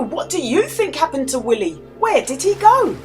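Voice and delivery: usual speaking voice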